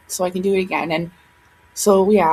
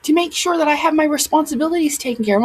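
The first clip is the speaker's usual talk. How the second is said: angry rant; 7 semitones higher than usual. Real, not acted. Ranting at rude commenters.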